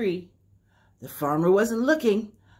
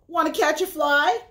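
rooster voice; 6 semitones higher than usual. Acted. Rooster invites the spider.

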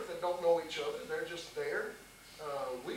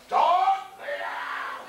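a loud, higher-pitched bark of a command with a tremor; Harry's gruff voice; 12 semitones higher, 6 dB louder, much slower than usual. Retold or imitated. Facing down the dogs, imitating Harry's shouted command. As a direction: bark it like a gruff old guy trying to sound in charge. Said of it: "tried to sound as much like Harry as I could".

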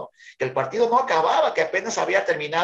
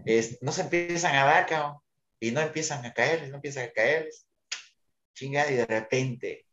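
ordinary talk, nothing marked

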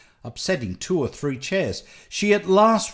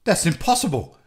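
raised-voice, distressed protest; crying out; 5 semitones higher, 5 dB louder, faster than usual. A narrator's reading. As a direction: cry out in protest, louder. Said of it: "cried Nelly".

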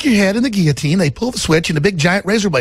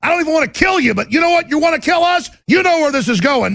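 furious shouting, pitched far up; angry rant; 7 semitones higher than usual. Real, not acted. Host raging at the New World Order, escalating shouts. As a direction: build from anger into high, strained yelling.

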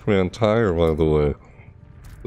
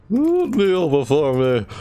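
slow, high mock command; drawn-out mock royal order; slow mocking quote; 8 semitones higher, 3 dB louder, slower than usual. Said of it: "I'm like".

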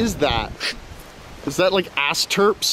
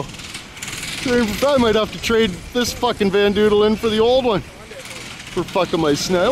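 ordinary talk, nothing marked

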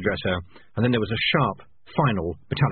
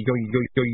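spinning plate wobble. A narrator's reading. Spinning plate sound imitated.

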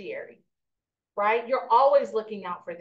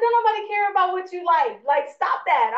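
mock scolding; 7 semitones higher, 5 dB louder than usual. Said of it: "I was like".

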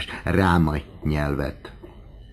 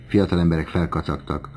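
ordinary delivery, nothing marked

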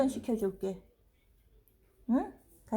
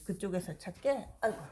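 ordinary talk, nothing marked

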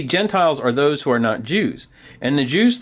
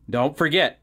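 ordinary delivery, nothing marked

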